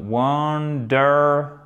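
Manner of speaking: wonderful slowly, drawn out by syllable; syllable-by-syllable model. Shown as a demonstration. Teacher assembles the phonetic symbols, slow syllabic wonderful. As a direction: stretch out each part of wonderful. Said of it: "Donc, ça nous donne".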